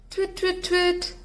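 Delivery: high twit calls; owl call. Acted. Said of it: "called Olive, trying again".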